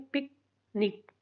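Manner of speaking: Picnic as short पिक, long pause, short निक; two short beats in picnic, far apart; split into syllables. Shown as a demonstration. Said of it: "छोटी ई की sound".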